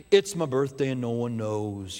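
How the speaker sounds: low, droopy mock Eeyore; moping donkey, sung low. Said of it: "Eeyore's birthday".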